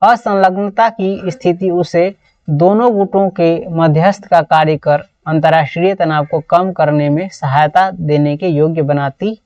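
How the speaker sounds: ordinary talk, nothing marked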